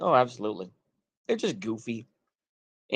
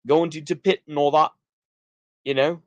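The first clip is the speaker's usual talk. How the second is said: attempted northern English accent; 3 semitones higher than usual. Shown as a demonstration. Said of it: "bit of a northern accent there".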